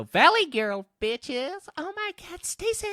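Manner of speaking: put-on squealed, very high Valley Girl voice. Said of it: "Just go".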